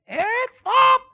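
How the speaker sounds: high, loud sharp yell of stop; shouted command. Retold or imitated. Father yelling at his small child to stop, loud. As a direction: bark the order to halt instantly. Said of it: "cukup satu kali teriak saya".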